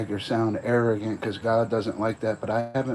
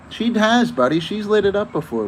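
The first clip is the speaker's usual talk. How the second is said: excited; 11 semitones higher, 6 dB louder than usual. Real, not acted. Hyped warning about girlfriend.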